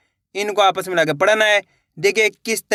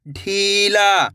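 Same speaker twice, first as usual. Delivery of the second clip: slow blending; much slower than usual. Shown as a demonstration. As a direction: draw the word out slowly, syllable by syllable. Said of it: "इसको इस तरीके से पढ़ लेना है". (तकलीफ को बहुत धीरे खींचकर बोलें)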